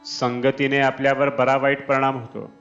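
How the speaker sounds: normal talking voice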